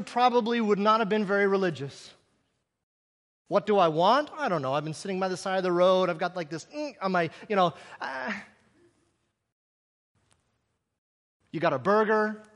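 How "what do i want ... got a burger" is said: mildly snarky. Retold or imitated. Preacher imagining his own answer, mildly snarky. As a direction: shrug it off sarcastically. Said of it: "I can be a little snarky".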